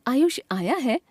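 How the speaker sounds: आयुष आया है asked as a question, rising at है; questioning tune. Shown as a demonstration. Teacher showing the question mark, Ayush आया है said questioningly. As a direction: lift the end of Ayush आया है to ask. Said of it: "प्रश्नवाचक".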